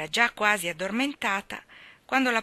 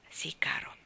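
soft, low, near-whispered reassurance; tender; 4 semitones lower, 7 dB quieter than usual. A narrator's reading. Anna forgiving little Davy, tender. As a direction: forgive him softly and low.

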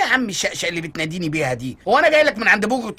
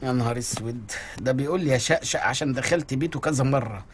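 much lower and softer, muttered to himself; dismayed aside; 8 semitones lower, 6 dB quieter than usual. Acted. Husband muttering to himself about his pushy friend, soft and dismayed. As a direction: say it under your breath, aghast.

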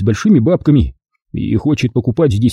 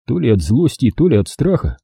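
plain reading voice

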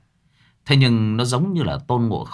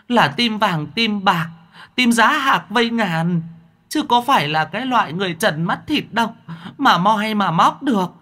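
fawning demon voice; 11 semitones higher than usual. Acted. Demon flattering Mặc to deny its threat, high wheedling. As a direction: flatter shamelessly and play dumb.

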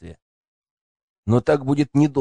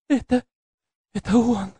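much higher, sobbing frightened whisper; panicked breathless whispers; 11 semitones higher than usual. A narrator's reading. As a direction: gasp it out through sobs. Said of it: "Всхлипывая, прошептала она". (испуганный всхлипывающий шёпот)

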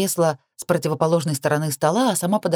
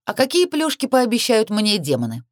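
raised, higher-pitched question; bright public challenge; spoken out loudly; 7 semitones higher than usual. A narrator's reading. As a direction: pipe up so everyone hears. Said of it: "произнесла я громко".

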